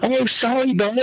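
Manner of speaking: high, guilty apology to the dog; suddenly remember and apologise to the dog; high sorry-bud voice; sheepish apology to the dog. Retold or imitated. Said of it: "And I'm like".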